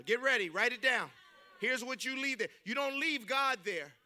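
ordinary talk, nothing marked

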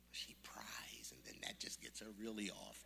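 unvoiced, confiding whisper; hushed storytelling aside. A narrator's reading. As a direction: lean in and whisper the gossip. Aside about the chatty sister.

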